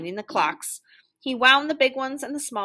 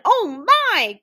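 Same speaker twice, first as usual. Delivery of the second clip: high, loud alarmed exclamation; shocked storybook cry; startled gasp; 9 semitones higher, 7 dB louder than usual. A narrator's reading. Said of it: "gasped Mister Winky".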